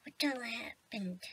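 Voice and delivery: slow, soft dazed whisper. Acted. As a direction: breathe it out, confused. Waking up dazed on floor.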